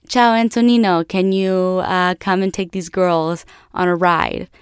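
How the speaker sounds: put-on Italian-accented phone greeting, lifted at first; put-on Italian English; imitated Italian father. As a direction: call out to a friend, mock Italian accent. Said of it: "this guy calls his friend".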